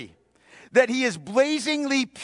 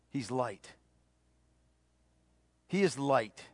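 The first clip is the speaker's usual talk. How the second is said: hushed emphasis; 10 semitones lower, 11 dB quieter than usual. Real, not acted. Preacher sinks to a hush.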